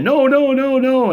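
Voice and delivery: high, loud frightened cries of no; terrified pleading. Acted. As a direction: beg for your life as the zombies close in. Zombie victim crying for mercy.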